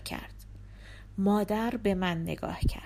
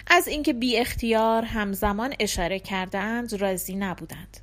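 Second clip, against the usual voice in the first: ordinary delivery, nothing marked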